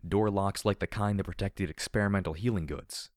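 ordinary delivery

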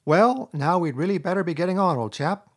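careless remark. Acted. Rat to weeping Mole, making light of leaving: breezy, offhand. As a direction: toss it off as if nothing's wrong. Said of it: "remarking carelessly".

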